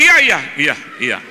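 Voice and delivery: loud, hurried yes yes; shouting into the phone. Retold or imitated. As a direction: shout agreement over a bad line. Shouting into weak phone signal.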